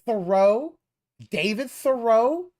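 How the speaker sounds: Thoreau? drawn out, high and loud; mocking pronunciation. Mocking a streamer's pronunciation of Thoreau. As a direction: repeat David Thoreau? slowly, mocking the odd stress. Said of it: "enunciating ... wrong syllables".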